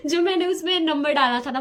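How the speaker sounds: high, loud giggly start; laughing. Real, not acted. Vlogger starting a funny phone-number anecdote, laughing. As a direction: giggle into the story.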